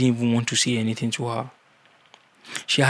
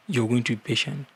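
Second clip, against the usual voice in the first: ordinary talk, nothing marked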